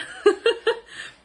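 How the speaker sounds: high, loud burst of laughing; high squeal of laughter. Real, not acted. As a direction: laugh at your own joke. Laughs at own joke, very high. (carcajada aguda)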